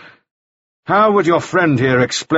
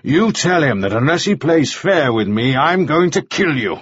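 higher-pitched, insistent menace; forceful threat; 4 semitones higher than usual. A narrator's reading. Mannering threatens Ah Suk at gunpoint, forceful threat. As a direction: press each demand as an order.

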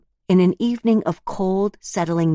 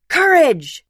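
very high, loud shout of "Courage!"; the crowd shrieking "Courage!" back; yell; 12 semitones higher, 7 dB louder than usual. Acted. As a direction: scream "Courage!" with everything. Crowd yells back again.

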